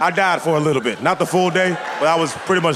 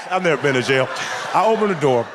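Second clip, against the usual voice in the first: usual speaking voice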